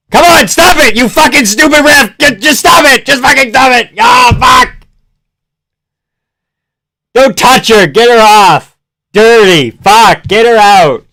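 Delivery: high, loud angry protests; furious yelling. Real, not acted. Fan protesting to the stupid ref, furious shouting. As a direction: yell at the ref in real fury.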